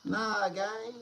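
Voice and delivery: normal talking voice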